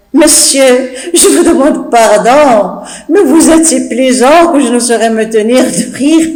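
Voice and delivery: words bubbling with laughter; laughing apology. Acted. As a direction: apologise but keep laughing. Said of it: "Nicole n'arrête pas de rire".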